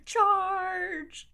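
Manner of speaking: high, loud battle cry; loud call. Real, not acted. Streamer suddenly yells a battle cry at her chat, loud and high. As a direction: yell it like leading a charge.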